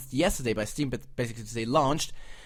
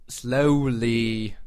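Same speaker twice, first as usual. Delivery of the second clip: drawn out slowly; 4 semitones lower, 5 dB louder than usual. Shown as a demonstration. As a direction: stretch "Slowly" out long and deliberate. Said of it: "let it try again, slowly".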